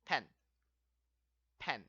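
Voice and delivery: pen said short and falling; model word pen. Shown as a demonstration. Teacher models pen for students, short and falling. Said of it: "how do you say pen?".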